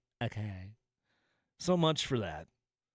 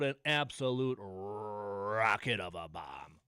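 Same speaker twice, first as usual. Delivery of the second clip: slow, drawn-out mock announcer, lower; quoting the announcer; 5 semitones lower, 3 dB quieter, slower than usual. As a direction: savour each word like the broadcaster did. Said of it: "And, and I quote".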